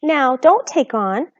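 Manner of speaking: slow, gentle soothing. A narrator's reading. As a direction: daughter gently soothing her mother. Arity soothes.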